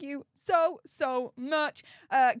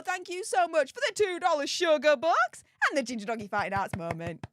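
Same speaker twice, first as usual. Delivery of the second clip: ordinary talk, nothing marked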